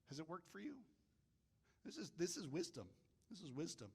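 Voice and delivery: very soft, sincere reflection; quiet earnestness. Real, not acted. Preacher pressing the point on calmness, hushed and earnest. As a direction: bring it right down, almost confiding.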